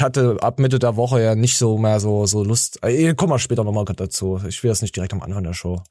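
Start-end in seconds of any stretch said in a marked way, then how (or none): none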